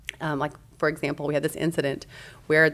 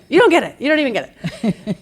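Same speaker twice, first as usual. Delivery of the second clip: much higher and louder, laughing and fired up; fired-up laughing insistence to her husband; on fire; 8 semitones higher, 10 dB louder than usual. Retold or imitated. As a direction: burst out, laughing with zeal. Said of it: "But I was just on fire".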